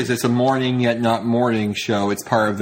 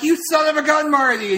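mock Joe Biden; 14 semitones higher than usual. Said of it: "Joe Biden's like".

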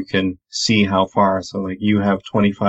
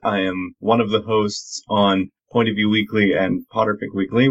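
ordinary talk, nothing marked

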